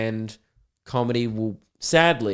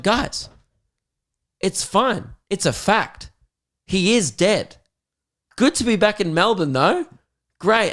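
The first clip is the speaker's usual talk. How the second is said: higher, sarcastic "it's fine" to the crowd; mock reassurance; 8 semitones higher, 3 dB louder than usual. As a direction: placate the audience with exaggerated cheer.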